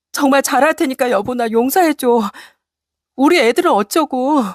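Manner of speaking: high, desperate pleading; begging wife. Acted. Wife begging husband to forgive her, desperate. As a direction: plead tearfully to stop him. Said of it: "애원하는 아내를".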